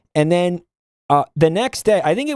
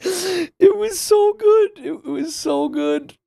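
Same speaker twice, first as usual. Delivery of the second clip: high, slow, wheezy laughing delight; 13 semitones higher, slower than usual. Real, not acted. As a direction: savor it through helpless laughter. Still laughing at the memory.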